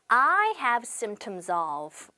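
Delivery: very slow, careful English model sentence; slow model phrase. Shown as a demonstration. Teacher modelling a travel phrase, very slow and clear. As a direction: spell out each word slowly for learners. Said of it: "이렇게 보여주시면 되겠네요".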